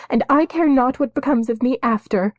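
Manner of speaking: high, loud desperate plea; girl crying her prayer to the sky. A narrator's reading. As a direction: cry it up to the sky. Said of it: "she cried into the thinning air".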